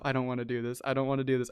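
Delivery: quiet mock dread at the roller coaster; mock inner dread muttered softly. Riding to Six Flags, muttering inner dread to himself quietly. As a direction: mutter it under your breath, dreading the ride. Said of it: "I'm just thinking to myself".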